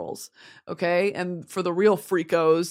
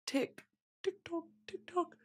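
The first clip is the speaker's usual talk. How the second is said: high, very soft ticking chant; tiny, hushed, high-pitched clock ticks; Hunger Games impression; 8 semitones higher, 14 dB quieter than usual. Streamer slips into a Hunger Games bit, hushed high impression. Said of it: "Both of those were Hunger Games impressions".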